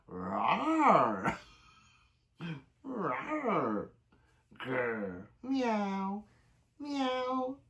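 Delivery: silly animal sounds for the stream; playful animal noises. Pretend. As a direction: run through roar, growl and meow for chat.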